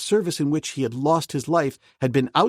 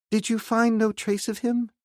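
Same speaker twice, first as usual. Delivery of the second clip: put-on higher young woman's voice, anxious; Jane Porter; 11 semitones higher than usual. Jane Porter asking Clayton about the lieutenant, high and anxious. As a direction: voice a worried young lady, pitched up. Jane's voice.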